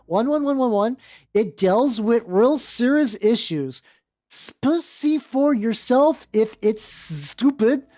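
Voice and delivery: slow, high, whiny defiance; mock defiant author. The author snapping at haters, slow whiny and high. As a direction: read it like a huffy teen author, slowly.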